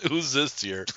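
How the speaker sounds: laughing while repeating the question. Real, not acted. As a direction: say it through a chuckle. Echoes co-host's dark joke, laughing.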